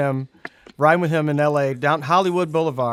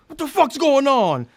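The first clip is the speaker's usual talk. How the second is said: cop demanding; 11 semitones higher, 4 dB louder than usual. Retold or imitated. Cop confronting Jay.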